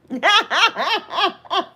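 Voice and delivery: high rapid laugh. Real, not acted. Joking about being roasted, high laugh.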